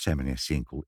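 plain reading voice

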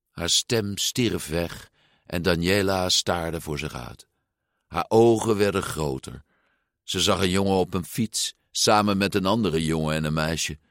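ordinary delivery

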